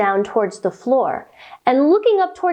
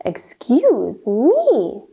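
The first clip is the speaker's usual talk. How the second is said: very slow, prim excuse me; mock-proper excuse me; polite excuse me; 3 semitones lower, much slower than usual. Shown as a demonstration. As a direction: draw it out like a polite giraffe. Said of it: "Then say".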